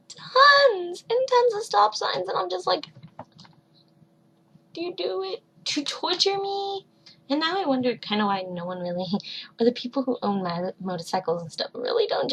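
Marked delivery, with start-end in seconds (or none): 0.1-6.9 higher, louder, slow exasperation at the stop signs; exasperated rant. Real, not acted. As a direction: vent about the traffic lights. Ranting at traffic lights.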